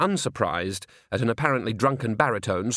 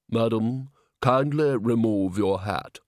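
pompous, slow, stiff request; pompous slow demand; slower than usual. Acted. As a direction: lord it over her. Red-faced gentleman.

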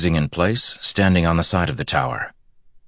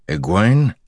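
rising, louder surprised question; 4 semitones higher, 5 dB louder than usual. A narrator's reading. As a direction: call the name as a question. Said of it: "Perrin asked".

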